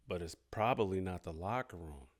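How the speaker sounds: low, very soft, deliberate punchline; quiet, low-pitched dig; hushed aside. Real, not acted. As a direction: drop it right down, almost to nothing. Pointed aside after louder setup.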